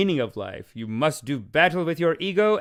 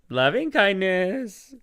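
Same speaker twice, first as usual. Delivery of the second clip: slow serene phrase; 5 semitones higher, much slower than usual. Pretend. Guru calming himself.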